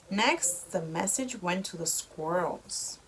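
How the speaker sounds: ordinary delivery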